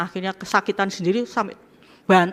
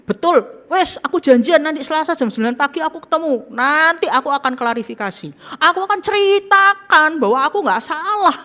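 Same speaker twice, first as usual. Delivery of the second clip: high, loud, defensive mock boast; hammed-up defensive whine; mock self-justifying voice; 9 semitones higher, 7 dB louder than usual. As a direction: play the proud one who won't admit fault. Mocking a self-defending attitude.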